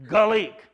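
"Gaelic" said gah-LEEK, without the long A; neighbor's Irish pronunciation. Retold or imitated. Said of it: "it wouldn't be an A sound".

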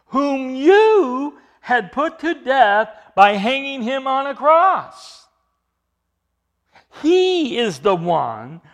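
loud, slow, accusing proclamation; emphatic proclaiming. Shown as a demonstration. Preacher accusing the council, loud and slow. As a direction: drive every word home slowly. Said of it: "it's emphatic".

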